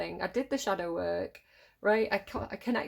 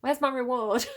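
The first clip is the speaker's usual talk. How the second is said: indignant demand; 4 semitones higher, 6 dB louder than usual. Retold or imitated. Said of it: "and you're like".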